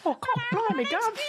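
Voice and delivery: high, excited cockney urchin squeal; old london cockney. Pretend. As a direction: chirp it like a cockney street kid. Voicing the Victorian urchin.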